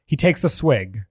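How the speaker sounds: normal talking voice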